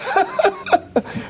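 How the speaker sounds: high-pitched forced laughing fit; imitated loud laughter. Rabbi out-laughing the crying girl, exaggerated high laughter. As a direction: laugh as if competing with someone's crying. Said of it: "I was laughing louder".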